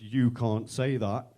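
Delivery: slow, deliberate mock telling-off; imitated scolding. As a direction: draw out each word in reproach. Offended girl scolds him.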